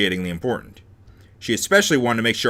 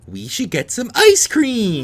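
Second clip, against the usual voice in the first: high, drawn-out gleeful suggestion; excited roommate; 10 semitones higher, 5 dB louder, slower than usual. Acted. Roommate suggesting a celebration, high excited voice. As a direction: propose it high, stretching the treat. Voices Mai's sudden idea.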